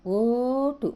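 long rounded O in ఓటు, said high and loud; demo vowel. Shown as a demonstration. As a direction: stretch the ఓ of ఓటు clearly. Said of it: "మళ్ళీ ఇది గమనించండి".

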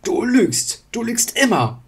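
high, loud angry shout; furious accusation. Acted. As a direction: yell the accusation. Wandel accuses Illidan. (wütender Ausruf)

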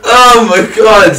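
loud, slow, high oh my god; shocked. Real, not acted. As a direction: blurt it out in disbelief. Reacting to disaster in game.

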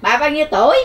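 loud, high repeat of the question; to someone hard of hearing. Real, not acted. Shouting question to deaf woman.

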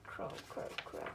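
breathy whispered crow crow; whispered crow call. Acted. Crows next door calling, whispered. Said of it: "called out".

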